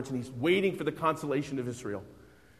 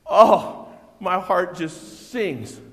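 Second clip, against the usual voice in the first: rapturous Simeon; 2 semitones higher, 8 dB louder, much slower than usual. Acted.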